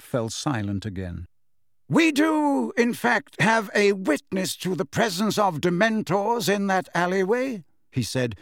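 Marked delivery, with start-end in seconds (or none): 1.9-7.6 put-on higher-pitched, deliberate old wizard; measured, emphatic announcement; Dumbledore, composed. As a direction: correct the court with polite assurance.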